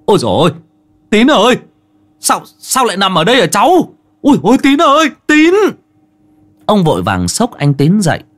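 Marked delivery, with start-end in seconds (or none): 0.1-5.5 high, loud, alarmed calling out; frantic high-pitched shouts of alarm; panicked cry. Acted. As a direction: cry out to rouse someone, in a panic. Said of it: "Ông Hải hốt hoảng chạy lại".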